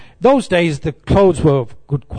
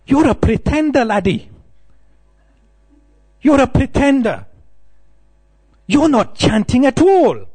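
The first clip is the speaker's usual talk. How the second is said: put-on high, scolding Scottish accent; 11 semitones higher than usual. Scottish monk scolds new devotee, Scottish accent. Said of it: "very good at Scottish accent".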